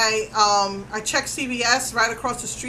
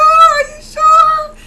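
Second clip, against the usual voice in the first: "Are you sure?" shouted very high and much louder, drawn out slowly; desperate shout; 13 dB louder, much slower than usual. Retold or imitated. Pleading with the scheduler again, in a drawn-out terrified shout. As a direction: wail "Are you sure?" long and loud in terror. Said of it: "I'm like".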